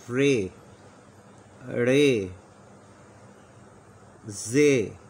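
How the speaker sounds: letter names called out loud and clear; loud letter demo. Shown as a demonstration. Teacher reading letters aloud to students, loud and clear. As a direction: sound each letter out for the class. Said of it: "तो दोबारा इसको पढ़ते हैं".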